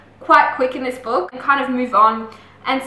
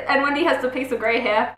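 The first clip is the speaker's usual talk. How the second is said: ordinary delivery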